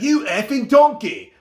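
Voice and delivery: high, loud Cockney yell imitating Ramsay; Gordon Ramsay impression. Vlogger imitates Gordon Ramsay cursing a contestant in a loud Cockney yell. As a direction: bark it in a Cockney accent like an angry chef. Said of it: "With his very Cockney accent".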